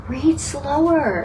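slow, drawn-out quote of Curtis's advice; a hint of Curtis, slow and deliberate. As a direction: stretch it out like Curtis reminding them. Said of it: "have you heard Curtis say".

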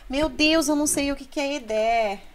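high, quick, baffled outburst; mock dismay. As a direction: wail like a lost dictionary searcher. Acting out a baffled learner. (desespero fingido)

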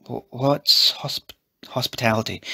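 slow, stumbling over the long word; falter, then try the word; hesitant question. A narrator's reading. Eliza asking about an unknown word, hesitant.

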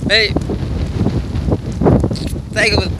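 normal talking voice

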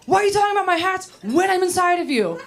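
high, whiny disbelief; exasperated guy. Retold or imitated. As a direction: whine it in outraged disbelief. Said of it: "He's like".

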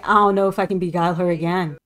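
ordinary delivery

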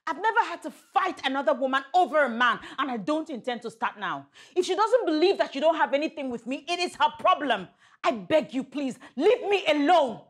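high-pitched, exasperated protest; indignant. Acted. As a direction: argue back, worked up and fed up. Quarrel after a public spectacle.

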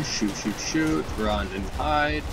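ordinary talk, nothing marked

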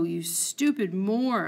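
usual speaking voice

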